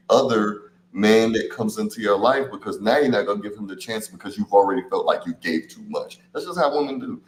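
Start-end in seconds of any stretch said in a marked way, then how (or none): none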